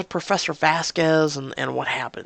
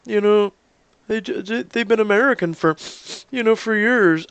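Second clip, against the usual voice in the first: mock complaining viewers; 7 semitones higher, 3 dB louder than usual. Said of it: "So for all those going".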